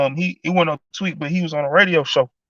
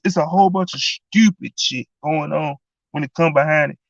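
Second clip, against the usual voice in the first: ordinary delivery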